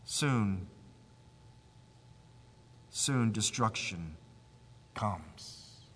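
low, hushed, slow-paced warning with long pauses; ominous low prophecy; grave foreboding. Real, not acted. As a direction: drop low and soft, sinking on the last word. Preacher foretells coming doom.

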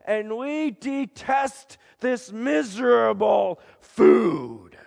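crowd yelling. Acted. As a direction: hurl the complaint at Moses. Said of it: "yelling at God, yelling at Moses".

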